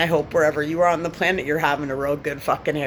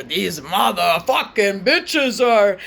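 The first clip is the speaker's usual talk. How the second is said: venomous drawl; 4 semitones higher, 5 dB louder, much slower than usual. Acted. Venom on insult.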